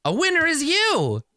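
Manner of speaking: Chukka's gleeful cry. Acted. Chukka crowing at the party over the fixed machine, squeaky high. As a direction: grab them by the shoulders and crow it way up high. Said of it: "Chukka turns to you and grabs you by the shoulders".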